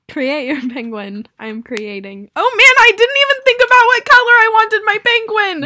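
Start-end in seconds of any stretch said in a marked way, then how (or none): 2.4-5.7 high, loud sudden dismay; excited outburst. Real, not acted. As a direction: blurt out the realisation. Sudden realisation.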